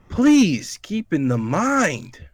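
slow, high, emphatic please keep in the mind; all caps reading. Shown as a demonstration. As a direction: spell it out like shouting letters. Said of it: "this is in all caps".

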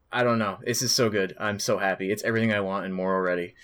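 normal talking voice

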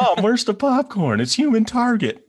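put-on high, eager little-kid voice; excited kid. Teasing his cohost, as a kid calling his mom. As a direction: call out like a child on TV night.

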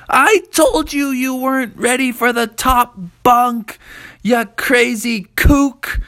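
very high, loud scolding mother; a mom telling him off; high, loud mother, slowing on the insult. A narrator's reading.

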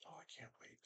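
whispered sarcastic aside; whispering. Real, not acted. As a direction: mutter it under breath.

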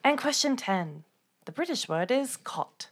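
put-on British accent. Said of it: "Did you like my accent?".

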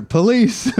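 squeaky high mock old man crying police; mocking falsetto cry of police. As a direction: whine it like a scandalized old man. Friend mocks old man calling.

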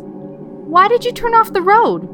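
a high confused wife. Acted. Wife voiced waking up.

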